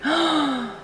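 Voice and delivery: sharp, high intake of breath; delighted little gasp; awed gasp. Real, not acted. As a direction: gasp at something gorgeous. Marvelling at pretty highlighter.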